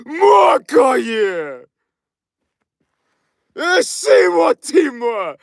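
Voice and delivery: high, shouted, near crying; overcome, crying out the chant; emotional outburst. Real, not acted. Streamer overcome with emotion during his chant, choked shouting. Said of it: "Sorry, I got a little emotional".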